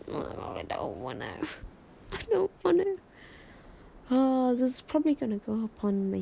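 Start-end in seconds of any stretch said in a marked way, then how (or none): 0.1-1.5 much lower, softer groan; reluctant whine. Real, not acted. Streamer doesn't want to end the stream, low grumbling whine. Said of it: "reluctant to end stream". 2.1-3.0 whiny protest. Real, not acted. Streamer pleads to keep streaming, high whiny protest. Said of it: "reluctant to end stream".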